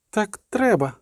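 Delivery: put-on high, hushed girl with a slight tremble; a touch of tremor in a high child's voice; trembling voice. A narrator's reading. Girl telling the sorceress it must be so, trembling lightly. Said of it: "сказала тремтливим голосом Цірі".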